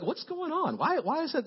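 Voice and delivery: high-pitched, exasperated questioning; retold frustration. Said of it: "This is frustrating to me".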